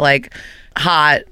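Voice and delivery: ordinary talk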